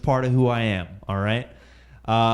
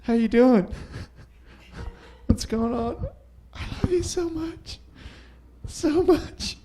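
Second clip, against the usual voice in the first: put-on high, doting baby talk to a newborn; cooing new parent; 12 semitones higher than usual. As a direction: gush over the little one with love. Cooing at his stool baby.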